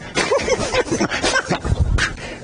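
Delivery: snorting laugh through the nose; sinus laugh. Retold or imitated. Preacher imitating a sinus laugh, snorting through the nose. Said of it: "the laughter through the sinus".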